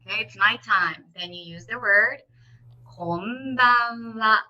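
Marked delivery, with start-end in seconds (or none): 2.9-4.4 "konbanwa" drawn out very slowly; slow practice. Shown as a demonstration. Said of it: "slowly first".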